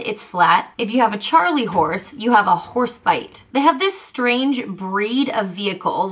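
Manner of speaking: normal talking voice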